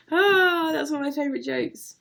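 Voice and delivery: high, loud gleeful cry; giddy delight. Real, not acted. Still laughing at own joke.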